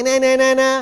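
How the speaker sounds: be nasty with it; jeering na-na; nasty taunt. Shown as a demonstration. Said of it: "Be nasty to me".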